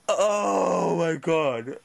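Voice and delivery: low, long-drawn tearful moan; crying. Real, not acted. Man despairing at the Global TV report, crying. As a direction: groan it slowly through real tears. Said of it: "fucking crying right here".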